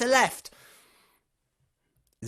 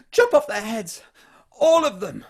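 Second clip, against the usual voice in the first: royal command shout; 4 semitones higher, 9 dB louder than usual. Acted. King orders mass execution.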